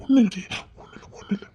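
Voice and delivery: gentle whispered reassurance; soothing whisper. Acted.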